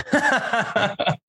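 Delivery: high, drawn-out mock bewilderment through laughter; laughing, hammed-up identity crisis. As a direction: squeal it helplessly through giggles. Retells identity doubt while laughing.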